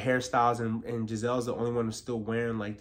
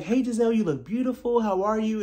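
high, louder sugary hello; mock sweet greeting; 9 semitones higher, 4 dB louder than usual. Mocking Wendy greeting Gizelle, high and sweet.

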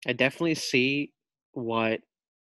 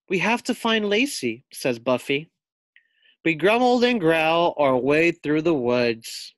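put-on reading to children; 3 semitones higher, 5 dB louder than usual. A narrator's reading. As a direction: read it out lively to young pupils. Teacher reads picture book aloud.

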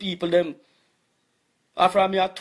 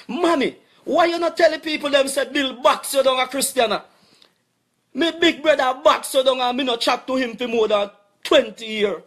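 loud, raised, furious calling out at mommy; angry shouting; 6 semitones higher, 4 dB louder than usual. Real, not acted. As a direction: yell the accusations at her, pitch up high. Accusing his mother publicly.

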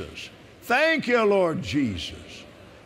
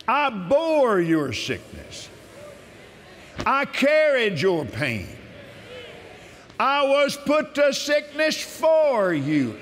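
slow, solemn reading of God's promises; measured devotional scripture reading; scripture proclamation; 7 semitones higher, slower than usual. Acted. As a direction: proclaim each verse as a promise. Reads healing scriptures aloud.

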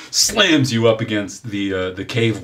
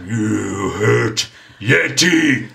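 Yeti voice; 5 dB louder, much slower than usual. Acted.

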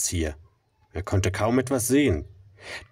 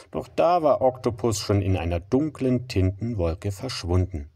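plain reading voice

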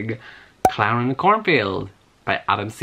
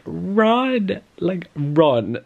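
mock yelling; 7 semitones higher, much slower than usual. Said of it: "I'm literally like".

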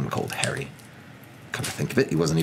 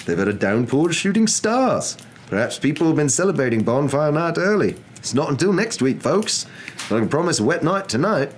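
higher, lively forecast patter; TV weatherman; 5 semitones higher, 3 dB louder than usual. Acted. Weatherman telling viewers of shooting stars, lively broadcast voice. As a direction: chat to viewers like a folksy forecaster. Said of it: "said the weatherman".

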